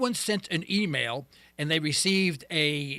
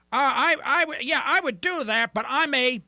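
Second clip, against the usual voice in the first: imitated caller; 8 semitones higher, 5 dB louder than usual. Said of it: "they go".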